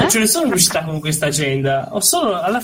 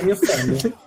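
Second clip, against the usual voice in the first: stifled giggles. Real, not acted. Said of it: "non ridete di me".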